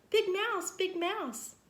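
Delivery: high, loud panicked calling; little mouse scared of a ghost; frightened yell. A narrator's reading. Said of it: "he yelled".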